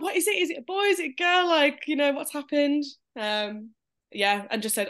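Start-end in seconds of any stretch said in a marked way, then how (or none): 0.0-2.8 high, eager questions tumbling out; family on FaceTime demanding the news, high and eager. Retold or imitated. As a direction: pester for the news, pitched way up. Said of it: "They were like".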